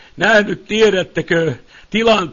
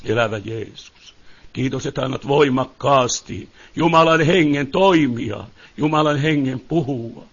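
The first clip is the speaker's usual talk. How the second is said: soft, low spoken prayer to Jesus; hushed prayer; 7 semitones lower, 4 dB quieter than usual. Real, not acted. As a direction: pray quietly, turning inward. Preacher breaks into prayer.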